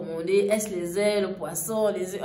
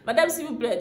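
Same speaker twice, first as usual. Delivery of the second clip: high and laughing through the words; 7 semitones higher, 3 dB louder than usual. Retold or imitated. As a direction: plead while laughing. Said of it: "j'ai éclaté". (supplier en riant, voix haute)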